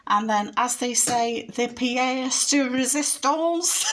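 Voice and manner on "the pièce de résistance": slow, hammed-up French accent on pièce de résistance; playful French accent, drawn out slowly; dodgy French accent. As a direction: savour it in a mock-posh French accent. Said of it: "sorry about my dodgy French accent".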